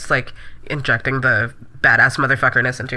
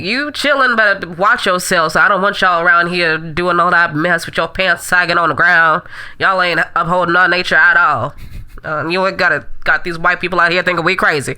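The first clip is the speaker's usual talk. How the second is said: Miss Claretha. Acted. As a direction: scold them like a sassy auntie.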